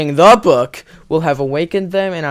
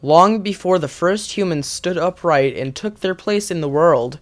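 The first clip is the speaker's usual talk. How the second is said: ordinary talk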